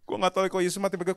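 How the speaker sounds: awkward self-mocking imitation; trying his style. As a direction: try on someone else's voice in the mirror. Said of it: "Saya pernah iseng, eh, di kaca".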